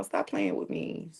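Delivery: normal talking voice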